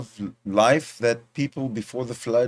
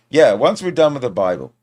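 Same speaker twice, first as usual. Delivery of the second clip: loud, raised voice; too loud; 4 semitones higher, 9 dB louder than usual. Real, not acted. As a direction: talk far louder than needed, a bit higher. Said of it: "I see that I'm screaming".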